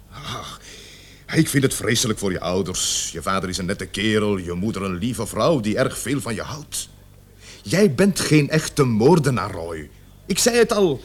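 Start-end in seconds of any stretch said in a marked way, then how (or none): 0.1-9.9 softer, gentler appeal. Acted. Officer appeals to Roy about his parents, gently. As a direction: lower and quieter, like a father talking to a son.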